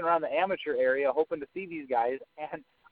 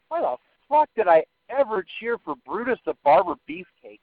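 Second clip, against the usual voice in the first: higher-pitched mock disbelief; incredulous hindsight; 6 semitones higher, 3 dB louder than usual. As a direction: ask it baffled at your younger self. Mock hindsight disbelief at old favourite.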